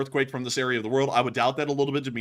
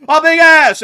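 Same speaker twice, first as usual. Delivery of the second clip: loud; 13 semitones higher, 16 dB louder, much slower than usual. Real, not acted. Loud joking emphasis.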